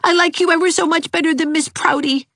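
put-on high, keen little girl; a child eager to agree; high, eager girl's voice. A narrator's reading. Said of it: "said Geraldine".

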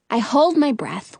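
plain reading voice

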